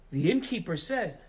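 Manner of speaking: normal talking voice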